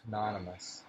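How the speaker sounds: ordinary talk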